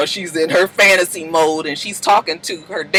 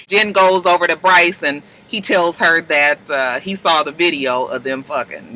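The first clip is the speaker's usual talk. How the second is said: ordinary talk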